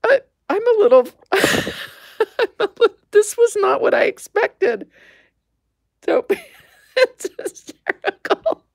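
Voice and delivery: high, giggly voice breaking into laughter; laughing. Real, not acted. Crafter finding a bug in the cocoon, high laughing.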